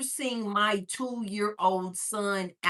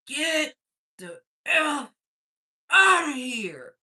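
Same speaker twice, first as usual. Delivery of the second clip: loud-ending, higher imitation of a man's order; grown man's voice; 4 semitones higher, 4 dB louder, slower than usual. Gamer ordering a kid out, as a grown man's voice. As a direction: imitate a man ordering someone out, drawn out. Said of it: "It's a grown man's voice".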